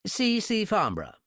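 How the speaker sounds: high, affected English; exaggerated British accent. A narrator's reading. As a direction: announce the name in upper-class English. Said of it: "high-class English accent".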